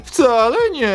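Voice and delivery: high squeaky hamster denial; chipmunk. Acted. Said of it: "Dlaczego gadasz jak chomik świr".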